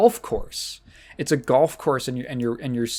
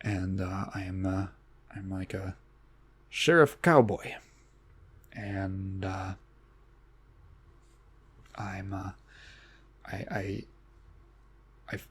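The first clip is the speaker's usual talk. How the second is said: put-on vaguely European Depp accent, dropping much lower and softer; fading Johnny Depp impression; 6 semitones lower, 10 dB quieter than usual. Depp impression fading out as the joke drifts off. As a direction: trail the Depp voice off into a near whisper. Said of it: "weird accent he has where he goes".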